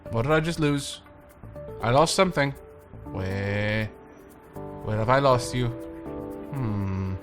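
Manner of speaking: ordinary delivery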